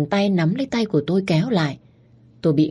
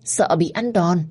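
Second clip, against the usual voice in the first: ordinary delivery, nothing marked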